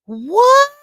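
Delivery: hammed-up disbelief; mock shock. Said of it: "Marilyn likes when I go".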